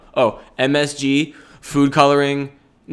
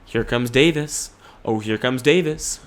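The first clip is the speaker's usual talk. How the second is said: ordinary talk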